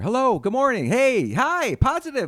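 high, loud, gushing hellos; over-the-top cheerful greeting. Acted. Comic mocking what the gym's front desk girl wanted, loudly over-cheerful. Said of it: "She wanted, every time I came in there".

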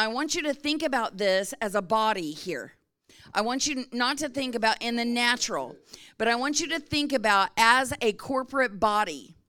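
ordinary talk

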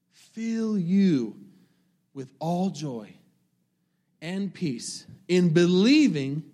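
slow, drawn-out reading, rising then falling; preacher rereading the verse with fervor; preaching a verse. Acted. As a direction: stretch every word of the verse.

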